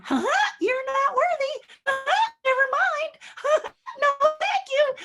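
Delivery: very high squeaky cartoon falsetto, dismissive; loud falsetto mouse mockery; Mickey Mouse. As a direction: imitate a cartoon mouse turning someone down, giggling. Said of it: "picture Mickey Mouse saying that".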